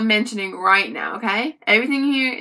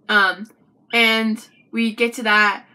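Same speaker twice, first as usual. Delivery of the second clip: ordinary talk, nothing marked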